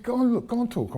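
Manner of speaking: high, insistent retelling of a colleague's urging; mock-urgent go on; urgent retold call. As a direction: push him out the door, pitch way up.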